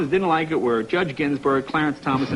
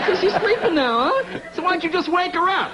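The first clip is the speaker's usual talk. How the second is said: high-pitched, incredulous challenge; mock indignation; 12 semitones higher than usual. Husband challenging the Frenchman on the phone, mock indignant. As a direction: pitch it way up, hammed-up outrage.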